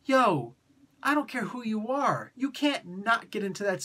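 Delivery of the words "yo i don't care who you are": ordinary talk, nothing marked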